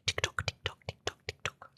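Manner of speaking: hushed, whispered echo; playful whisper. Pretend. Streamer playing with the app's name, whispered. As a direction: whisper it like a ticking clock.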